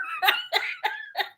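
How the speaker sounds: high squealing laugh; helpless laughter. Real, not acted. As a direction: laugh till you cry. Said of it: "I am laughing so hard".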